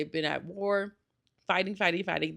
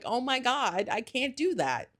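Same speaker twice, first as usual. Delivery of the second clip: ordinary talk, nothing marked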